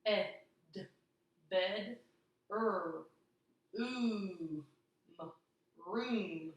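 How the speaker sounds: bed then room, each sounded out slowly; stretched segmented bed and room; sounding out bedroom. Shown as a demonstration. Teacher sounding out bedroom for the class. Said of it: "So let's sound that out".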